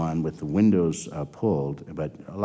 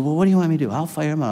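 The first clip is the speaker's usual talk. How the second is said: high, louder, flustered retold reply; panicky boss; 10 semitones higher, 5 dB louder, much faster than usual. Lab head scrambling to please the boss, high and flustered. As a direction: blurt it out like a panicking manager.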